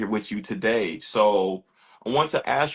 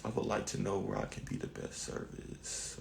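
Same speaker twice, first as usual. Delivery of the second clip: muttered aside; 5 semitones lower, 16 dB quieter than usual. Real, not acted. Host muttering while setting up live.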